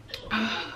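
a long, soft sigh of grief, breath let out slowly; heavy sigh. Real, not acted. Vlogger pausing over the murdered child, heavy real sigh. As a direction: let out a tired, heartbroken breath.

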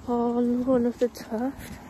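lip pulled down by finger; talking around a finger; finger in mouth. Real, not acted. Seen 1: finger pulls lower lip over braces while talking.

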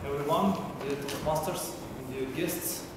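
ordinary talk, nothing marked

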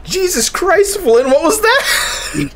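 hyped commentator shouting the driver's name; excited yelling. Real, not acted. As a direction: lose it at the meanest block ever. Caller reacts to a wild block.

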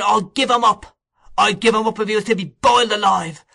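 put-on high, loud boy's tirade; building defiant outcry, pitched far up; boy working himself up into shouting. A narrator's reading. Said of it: "becoming more and more excited as he spoke".

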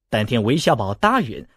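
usual speaking voice

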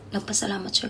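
whisper. Real, not acted. Telling secrets about employers.

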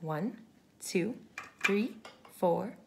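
usual speaking voice

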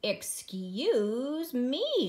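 very drawn-out mock shout; indignant shout. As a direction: stretch it out in offended protest. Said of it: "shouted the rabbit".

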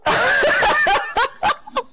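high, loud helpless laughter; loud laughing fit. Real, not acted. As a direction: burst out laughing, can't stop. Laughing fit after choking.

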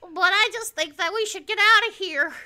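high, loud put-on character voice; Clarence. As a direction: play a worried, high-pitched character. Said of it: "Can you give us some Clarence".

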